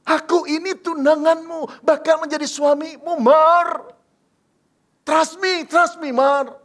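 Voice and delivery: loud, high, urgent plea; emotional pleading. Acted. Emotional fiancé pleads loudly with Mary for truth. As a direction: grab her shoulders and beg for truth. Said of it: "dia pegang pundak Maria dan berkata".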